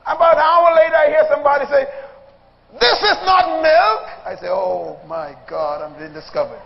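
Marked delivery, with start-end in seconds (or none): 2.8-4.0 mock indignant discovery. Sibling discovering water. 4.5-6.6 very low, slow, hushed "Oh my God"; mock panicked thought.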